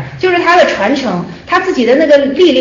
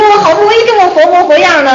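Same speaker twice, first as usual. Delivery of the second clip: a raised, louder protest, half laughing; surprised protest; 6 semitones higher, 4 dB louder than usual. Retold or imitated. The student protests to the teacher, raised and amused. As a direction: push back as if wronged, a little higher.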